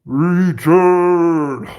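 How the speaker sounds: loud, slow, drawn-out movie command; Time Bandits quote. Acted. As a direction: boom it out like a commanding film apparition. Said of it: "Everybody remember Time Bandits?".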